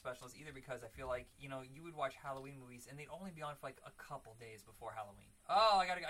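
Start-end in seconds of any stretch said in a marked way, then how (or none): 5.5-6.1 loud, higher-pitched eager outburst; urgent excitement. Retold or imitated. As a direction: act out a sudden must-watch urgency. Acting out old urgency to watch.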